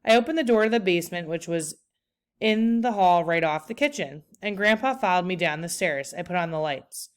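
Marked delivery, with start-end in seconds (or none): none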